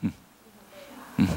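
normal talking voice

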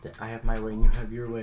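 mildly heated retold argument; imitating a yelled argument, half-strength; retold yelling. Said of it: "I was yelling and screaming".

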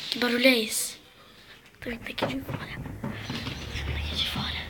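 soft trembling whisper; scared whisper. Real, not acted. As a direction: whisper it, terrified. Hushed fear, door opened.

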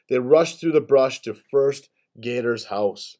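plain reading voice

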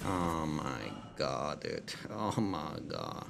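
stunned disbelief. Real, not acted. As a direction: let it sink in, very slowly. Stunned aftermath.

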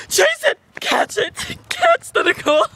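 excited yelling. Pretend. Hunt call.

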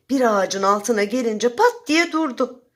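ordinary delivery, nothing marked

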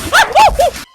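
high squealing laugh; burst of laughter. Real, not acted. Laughing out loud at his friend's crab.

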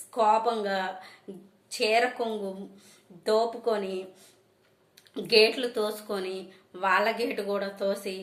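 ordinary delivery

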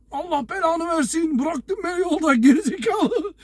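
high, slow mock rant with a laugh breaking through; mock cursing. Abandoned friend cursing the driver at the station, mock rant. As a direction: imitate a friend swearing at you, half laughing. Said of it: "Nasıl saydırıyor bana, sövüyor".

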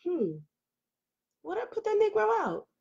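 high, soft pondering to herself; hesitant musing. Retold or imitated. Woman retelling how she hesitated over the question, hesitant musing. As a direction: wonder it aloud in a small, high voice. Acting out her own hesitation.

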